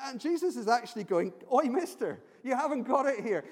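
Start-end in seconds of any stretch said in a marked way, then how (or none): 1.5-3.4 loud, raised-pitch derogatory call-out; acted blunt telling-off; harsh rebuke. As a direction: pull a stranger up sharply. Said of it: "very harsh".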